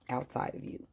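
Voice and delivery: ordinary talk, nothing marked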